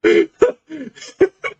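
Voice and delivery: gleeful rising lich cackle; high villain cackle. Acted. GM villain laugh.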